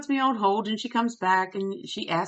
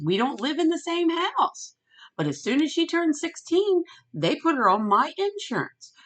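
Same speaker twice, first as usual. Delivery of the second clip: much higher-pitched retold complaint; retold indignation; 11 semitones higher, 4 dB louder than usual. Sister telling of her granddaughter put on her insurance, indignant.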